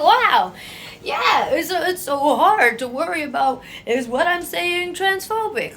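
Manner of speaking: high, loud, laughing sarcasm; mock them with exaggerated wow; sarcastic mock reply. Mocking reply to the complaining straight person, sarcastic. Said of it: "I'm like".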